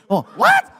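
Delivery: high loud startled yelp; shocked. Retold or imitated. Comedian reacting to the Mexican joke, high shocked yelp. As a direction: jump up in mock outrage.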